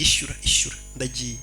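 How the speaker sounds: low, very soft imitated order; quiet, falling throwaway command; offhand boss order. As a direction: imitate him, dropping to a near murmur. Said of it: "agahita anavuga ngo".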